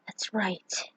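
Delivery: breathy intimate whisper; hushed, voiceless whisper. A narrator's reading. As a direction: whisper it close to her ear. Lovers' intimate moment.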